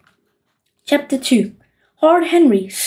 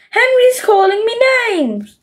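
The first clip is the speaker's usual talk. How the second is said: much higher whining; whining tattle; 8 semitones higher than usual. A narrator's reading. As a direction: tell on your brother, whining. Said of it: "wailed Peter".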